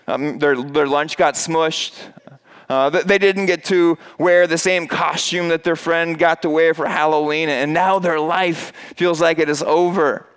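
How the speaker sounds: ordinary delivery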